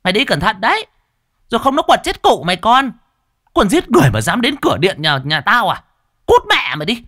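high, loud hands-on-hips tirade; furious yelling. Acted. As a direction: scream abuse after the fleeing man. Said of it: "chống nạnh đứng giữa sân thở hồng hộc mà chửi theo".

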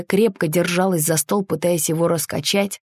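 plain reading voice

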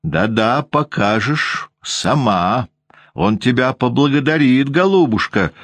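ядовито и заметно выше, с издёвкой; растягивай с насмешкой, как злая старуха; язвительно. A narrator's reading. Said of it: "язвительно говорила Анна Григорьевна". (воспитательница язвительно грозит Ванде, выше и насмешливо)

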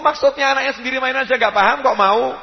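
normal talking voice

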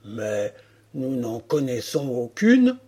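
low, gruff voice of the big boar leader; lightly growled boar answer; boar chief voice. Acted. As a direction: speak low as the chief of the wild pigs. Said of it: "le chef des cochons marrons lui répondit".

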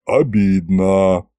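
low, very slow dragon drone; droning dragon, hurt. A narrator's reading. Right dragon head complains of a cold welcome, low drone. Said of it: "прогудела правая голова Горыныча".

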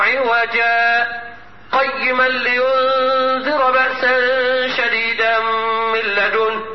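slow chanted recitation, a short breathless pause at عوجا; recitation with a brief silent pause. Shown as a demonstration. Teacher demonstrating correct sakt to students. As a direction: chant it slowly, hold عوجا briefly without breathing, then قيما. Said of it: "هكذا".

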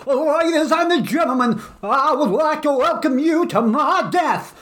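put-on high, slow old-school preacher shout; prosperity preacher. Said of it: "put on your best prosperity preacher voice".

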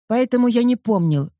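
plain reading voice

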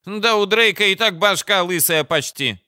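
high, loud mocking exclamation; exclaiming. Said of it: "воскликнула Бадая".

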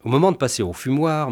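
ordinary delivery, nothing marked

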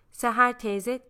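ordinary delivery, nothing marked

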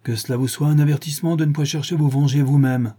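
stern warning to the man. A narrator's reading. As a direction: warn him severely. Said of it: "le regardant sévèrement".

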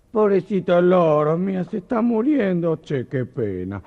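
put-on high, slow pitying old lady in Argentine accent. Old lady finding the fainted parrot, Argentine accent. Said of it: "contarlos con acento argentino".

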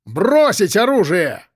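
slow, high, stretched-out soldier's order; shouted command. A narrator's reading. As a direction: bellow it with every word drawn out. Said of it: "закричал один из них".

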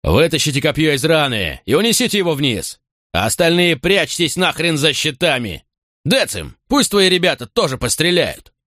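high barked battle commands; gruff barked parade orders. Acted. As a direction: bark orders over the fight. Centurion orders under spear fire. (отрывистые громкие приказы)

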